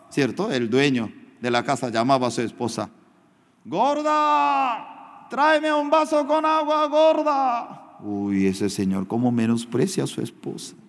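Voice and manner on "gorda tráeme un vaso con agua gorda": husband hollering for water; calling out to wife. Retold or imitated. Husband calls wife from room.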